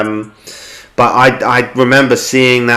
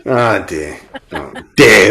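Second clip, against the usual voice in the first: polite laugh; 6 semitones lower than usual. Real, not acted. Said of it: "I'm trying to do my polite laugh".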